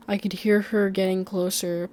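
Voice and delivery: plain reading voice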